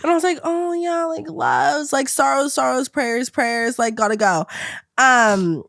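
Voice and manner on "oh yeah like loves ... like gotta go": higher, bright fake-friendly voice; fake cheerful. Reassuring the sobbing roommate, higher fake-cheerful. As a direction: gush insincerely to get away.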